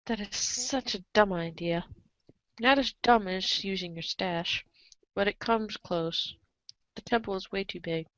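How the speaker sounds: ordinary delivery, nothing marked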